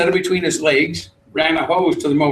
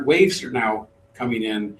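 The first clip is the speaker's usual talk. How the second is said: normal talking voice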